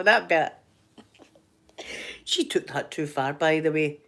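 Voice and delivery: usual speaking voice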